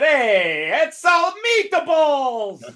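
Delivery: hammed-up Italian accent, high and loud; mock Italian accent. Player teasing an Italian opponent about his hand, mock Italian accent. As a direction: sing it out long in a cartoon Italian accent. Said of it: "I laughed like that".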